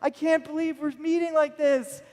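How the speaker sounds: put-on the girl from the plane, overjoyed to see him; happy young woman. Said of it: "embracing me and saying".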